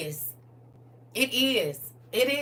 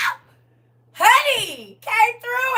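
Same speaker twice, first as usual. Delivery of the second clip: high, loud laughing shout; laughing whoop; 12 semitones higher, 8 dB louder, slower than usual. Retold or imitated. Internet mama laughing loudly as her girl struts. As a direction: shriek with delighted laughter.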